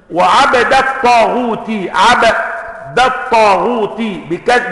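وعبد الطاغوت slow, with a sukun on the ba and kasra on the ta: wa-'abdi t-taghuti; reading of al-Hasan. Shown as a demonstration. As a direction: slowly spell out عبد الطاغوت as 'abdi t-taghuti. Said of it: "بسكون الباء وكسر التاء". (وعبدِ الطاغوتِ بسكون الباء وكسر التاء)

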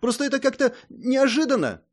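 high-pitched flustered protest; indignant. A narrator's reading. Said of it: "возмутилась Мэй".

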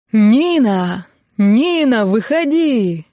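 loud, rising drawn-out hollering; yelled call. A narrator's reading. Lads under the window calling the bride out, yelling. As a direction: holler up at a window like a rowdy crowd. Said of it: "Теперь орали хором несколько человек".

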